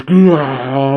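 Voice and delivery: long imitated monster growl; ghoulish growl. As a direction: draw out a spooky, hammed-up growl. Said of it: "she gives out a ghoulish".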